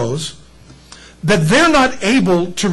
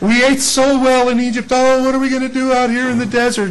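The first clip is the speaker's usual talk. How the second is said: higher, hammed-up whiny complaint; mock whining; 6 semitones higher than usual. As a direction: whine it up high like grumbling wanderers. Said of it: "they were whining".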